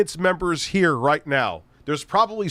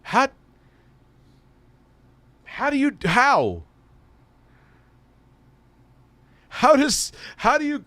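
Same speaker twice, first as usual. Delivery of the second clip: baffled disbelief; 13 semitones higher than usual. Real, not acted. Baffled by the Crowder fact.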